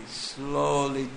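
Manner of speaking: ordinary talk, nothing marked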